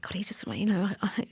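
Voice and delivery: soft broken whisper, searching for words; hushed whisper. Retold or imitated. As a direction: whisper so as not to wake anyone. Retold night feeding, quiet house.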